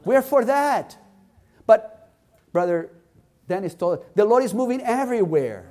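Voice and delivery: high, impassioned preacher's voice; fervent, ringing exhortation; full-force preaching. Real, not acted. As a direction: build it up and proclaim it to the congregation.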